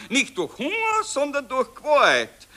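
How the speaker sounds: emphatic correction. Acted. General correcting the dictated report, loud and high. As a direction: correct yourself loudly, high and firm. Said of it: "Ah, was red ich?".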